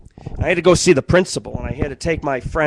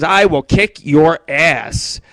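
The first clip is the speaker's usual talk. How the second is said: slow mock threat; 3 dB louder, much slower than usual. Brenna's threat.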